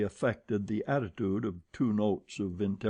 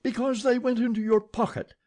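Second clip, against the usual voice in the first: high, loud frightened gasp; 12 semitones higher, 5 dB louder than usual. A narrator's reading. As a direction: gasp it at the devil himself. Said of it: "gasped the old woman".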